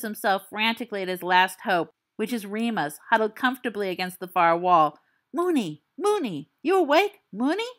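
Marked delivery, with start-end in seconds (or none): 5.3-7.7 high, urgent calling; frantic wake-up call. Acted. Sirius desperately tries to wake Remus, high and urgent. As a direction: pitch it high and pleading.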